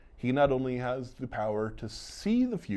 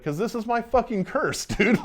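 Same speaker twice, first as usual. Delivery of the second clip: much higher, laughing through a frustrated outburst; exasperated Johnny; 12 semitones higher, 4 dB louder than usual. Retold or imitated. Johnny telling Roger his gift is a curse, exasperated outburst. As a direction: throw up your hands at your own bad luck.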